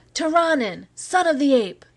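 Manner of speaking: high jeering yell of abuse; abusive howl. Acted. Mob howling abuse at Cargill, high jeering yell. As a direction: fling it at him like a mob insult. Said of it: "a howl of abuse went up".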